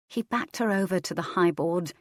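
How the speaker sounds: ordinary delivery, nothing marked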